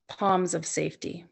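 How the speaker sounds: ordinary talk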